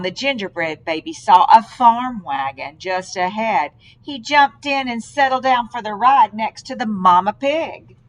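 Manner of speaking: plain reading voice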